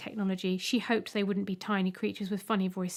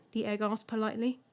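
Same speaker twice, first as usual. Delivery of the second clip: plain reading voice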